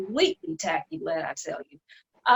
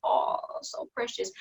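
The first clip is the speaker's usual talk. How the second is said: sarcastic coo pitched far up and softer; mocking sweetness; 13 semitones higher, 6 dB quieter than usual. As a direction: sneer it as fake tenderness. Mocking the sisterly moment.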